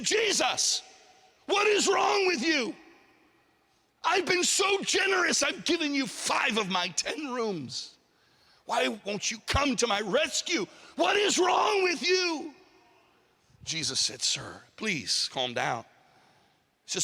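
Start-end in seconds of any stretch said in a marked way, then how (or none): 0.0-12.5 high-pitched, indignant shouting in acted anger; angry outburst. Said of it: "he got angry". 14.2-15.8 low, slow, measured reply; calming. Acted. Jesus soothes the angry man.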